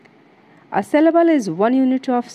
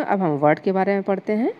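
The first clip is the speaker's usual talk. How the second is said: normal talking voice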